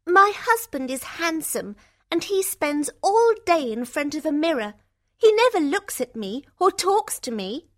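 high, loud complaining voice; unhappy sister. A narrator's reading. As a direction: complain about a husband, pitched well up. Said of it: "They are both very unhappy".

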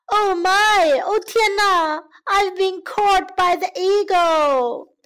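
high, panicked frog wail; distraught storybook frog, pitched high; regretful frog. A narrator's reading. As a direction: lament being caught, in acted alarm. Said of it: "这下青蛙可后悔了".

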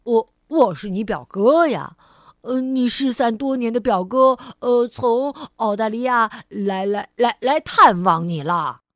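loud, jolly, stumbling over the words; cheerful stammer. Acted. Mr. Clumsy announcing himself as the long-lost cousin, cheerful loud stammer. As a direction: beam as you trip through the news. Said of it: "兴高采烈地说着".

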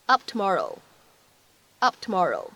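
"Up tomorrow" with P held, not released; unreleased P. Shown as a demonstration. Teacher demonstrating lost plosion to class. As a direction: drop the P burst in "Up tomorrow". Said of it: "我们可以读成up tomorrow".

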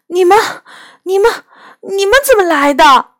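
fast, high, breathless stumbling question; tripping over words in excitement; excited stammer. Acted. Girl overjoyed to see the beasts, fast excited stammer. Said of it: "我激动得说不出话".

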